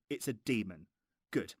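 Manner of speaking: ordinary talk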